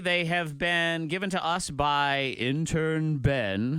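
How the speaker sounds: show announcer. Acted. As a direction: introduce the battle like a host. Announcing the rap battle.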